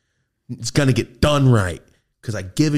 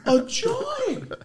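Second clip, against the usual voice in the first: surprised. Retold or imitated. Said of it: "I was like".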